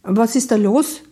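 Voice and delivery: calling out. Acted. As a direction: call the question over from a little way off. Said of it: "rief Flo".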